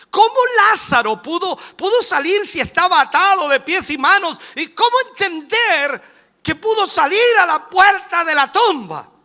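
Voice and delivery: high, loud doubter's questions; hammed-up indignant sceptic; mock incredulous protest.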